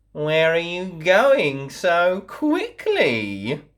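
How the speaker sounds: sly, slow wolf voice; wolf's question. A narrator's reading. Wolf stopping the girl on the path, sly and slow. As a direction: let the wolf probe slyly. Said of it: "asked the wolf".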